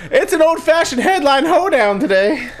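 high, theatrical show opener; hoedown announcer. Pretend. Host announces the old-fashioned headline game to co-hosts, high hokey announcer.

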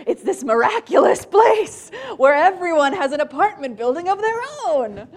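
high, loud, awestruck; childlike wonder. Retold or imitated. City kid marvels at suburban houses. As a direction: gush like a kid seeing a marvel. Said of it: "And I was like".

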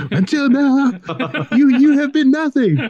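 high laughing mock drill sergeant bark. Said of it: "I imagine, like, a drill sergeant".

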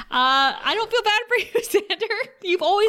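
laughing through a high teasing scold. Real, not acted. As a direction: giggle out the jab at Xander. Laughing while scolding Xander.

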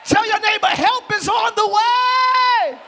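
high, shouted preaching; full-force preaching. Real, not acted. Preacher urging the church to rejoice before rescue, full-force preaching. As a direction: shout it out to fire up the congregation.

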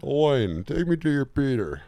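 high, loud booming alien command; booming arrogant alien overlord. Pretend. Continues alien voice.

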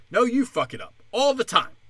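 ordinary talk, nothing marked

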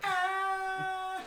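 high shocked cry; shocked yelp. Real, not acted. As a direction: yelp in mock hurt. Reacting to fat joke.

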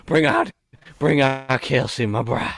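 Southern preacher. Acted. Mock preacher summoning the bride before the congregation, hammed-up. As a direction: call them up like a snake-church preacher. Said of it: "I'm picturing you in a church".

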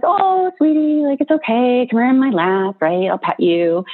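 gentle sing-song comfort, lifted and slowed; soothing tone. Retold or imitated. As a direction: soothe it like a frightened dog in your lap. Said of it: "So it's, like, tone and soothing".

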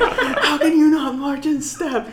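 very high, drawn-out mock tirade; slow, strained retold yell; mock scolding. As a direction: imitate an instructor's disbelief at sloppy marching. Instructor scolding marchers, retold.

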